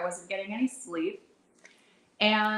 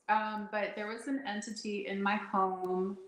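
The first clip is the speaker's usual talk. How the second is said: ordinary delivery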